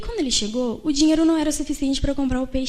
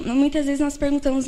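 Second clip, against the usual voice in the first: ordinary talk, nothing marked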